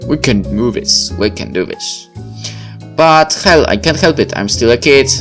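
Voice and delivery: ordinary talk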